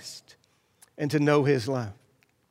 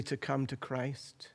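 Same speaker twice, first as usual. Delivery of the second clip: slow breathy whisper of thanks; grateful whisper; 4 semitones lower, 14 dB quieter than usual. Real, not acted. Preacher thanking those who led him, whispered gratitude. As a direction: let it fall to a whisper. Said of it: "I'm so grateful".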